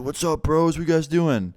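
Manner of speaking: put-on high-pitched, loud drunk bro greeting; drunk dude. Hammered host greets cookie sellers at the door, drunken bro voice. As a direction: imitate a hammered guy hailing his bros. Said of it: "he's hammered at".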